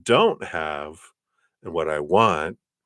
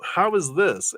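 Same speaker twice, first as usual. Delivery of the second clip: sharp high jump of disbelief; 14 semitones higher, slower than usual. Retold or imitated. Telling listeners about coworkers' equal reward, shocked high disbelief. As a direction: retell the shock of an unfair result. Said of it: "and I'd be like".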